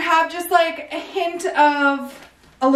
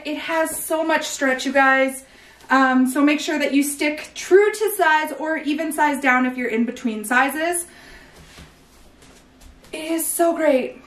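ordinary talk

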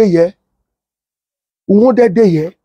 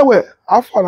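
usual speaking voice

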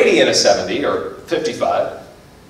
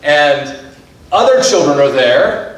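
ordinary talk, nothing marked

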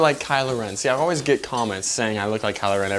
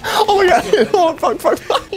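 high-pitched words through real laughter; helpless laughter. Real, not acted. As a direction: squeal it out while cracking up.

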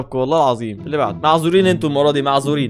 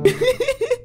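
high-pitched burst of laughter; 3 dB quieter than usual. Real, not acted. Startled host bursts out laughing.

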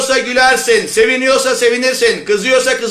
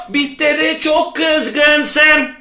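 very slow, higher, drawn-out words; empathetic mother; 4 semitones higher, much slower than usual. Acted. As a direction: stretch it out like an understanding mother. Mother's line repeated slowly.

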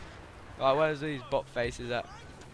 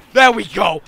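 loud, raised cheer; triumphant shout; 6 semitones higher, 16 dB louder than usual. Real, not acted. Player finally getting kills, triumphant shout. Celebrating success in game.

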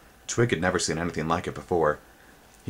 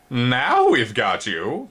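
slow, louder, higher insect voice; menacing insect; 6 semitones higher, 4 dB louder, much slower than usual. A narrator's reading. Spindlebug cornering Twig, slow gloating insect voice. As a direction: gloat slowly. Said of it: "came a voice".

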